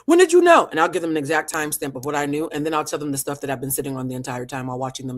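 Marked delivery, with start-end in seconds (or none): 0.1-0.7 surprised question. Retold or imitated. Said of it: "retold confessor asking".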